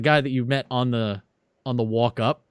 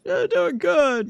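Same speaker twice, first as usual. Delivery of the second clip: much higher, slow crowd voice; crowd callback; slower than usual. Acted. Rally crowd answers the congressman, high and slow. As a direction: shout back like a happy rally-goer. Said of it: "bunch of callbacks".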